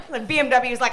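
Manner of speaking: normal talking voice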